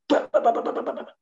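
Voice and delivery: high rapid ba ba ba; imitated falling sounds. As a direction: mimic people toppling one after another. People falling over sound. (tiruan bunyi orang berjatuhan)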